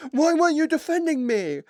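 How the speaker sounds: high, loud whiny complaint; mock the teammate's shrill whine. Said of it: "And they were like".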